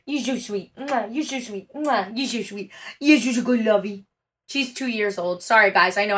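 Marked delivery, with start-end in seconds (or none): 0.1-4.0 dog talk. Real, not acted. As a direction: coo gently at your puppy. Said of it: "I talk dog to my dog".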